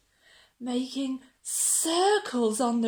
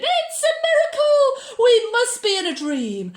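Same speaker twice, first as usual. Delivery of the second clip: high loud amazement; amazed shout; 10 dB louder than usual. Acted. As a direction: shout in astonished wonder. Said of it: "They shouted".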